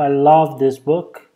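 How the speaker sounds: ordinary talk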